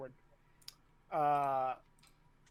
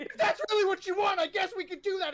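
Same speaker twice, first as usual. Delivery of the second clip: much higher, loud, fast outburst; shouted exchange; 7 dB louder, much faster than usual. Acted. Officer and excitable cadet trading words, shouted high.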